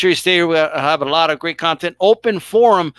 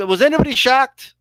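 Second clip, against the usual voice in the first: usual speaking voice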